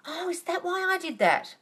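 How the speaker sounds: light mock aha moment; mock realisation. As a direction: act out the dawning surprise. Realising afterwards, acted quote.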